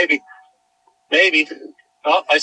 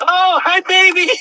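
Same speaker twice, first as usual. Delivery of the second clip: excited dog greeting; 13 semitones higher, 5 dB louder than usual. Real, not acted. Owner greeting her found dog Cammy, excited high baby talk. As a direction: gush over the found dog.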